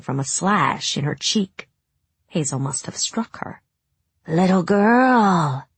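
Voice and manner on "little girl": slow, high, loud witch croak; hag voice. A narrator's reading. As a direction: savor it like a hungry hag. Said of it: "the hag said".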